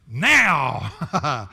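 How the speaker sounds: sudden loud demanding now. Pretend. Preacher as a huge parrot, a loud demanding now. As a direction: bark the word now like a greedy parrot.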